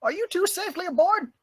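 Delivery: high-pitched captain calling out; ship captain. Acted. Captain checking on two climbers, high calling voice. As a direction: call across the deck in character.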